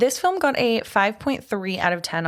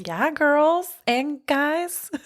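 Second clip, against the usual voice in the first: drawn-out cheer; 7 semitones higher, much slower than usual. Acted. Hammed prom cheer.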